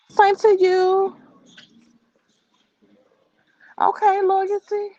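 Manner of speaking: high, slow sing-song greeting; drawn-out high chat read. Real, not acted. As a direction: stretch it out sweetly, high and slow. Reading chat comments aloud.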